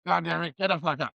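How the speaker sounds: mock angry wake-up call; pissed off. Yelling at sleeping roommate to get up, irritated. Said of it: "I'm pissed off".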